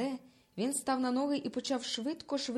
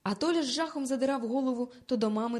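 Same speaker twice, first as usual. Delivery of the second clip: ordinary delivery, nothing marked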